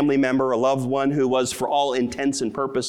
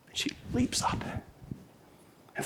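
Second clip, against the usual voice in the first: hushed whisper; 10 semitones higher, 12 dB quieter than usual. Real, not acted. Tender climax of reunion story.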